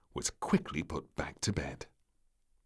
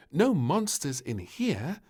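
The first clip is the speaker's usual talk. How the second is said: queen; 10 semitones higher, 6 dB louder, slower than usual. Acted. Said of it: "said the Queen".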